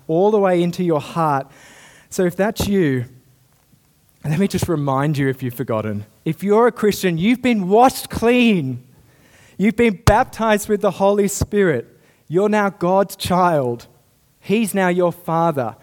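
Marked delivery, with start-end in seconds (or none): 6.2-15.8 fervent preaching. Acted. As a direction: preach it out with rising passion. Preacher climaxes on grace.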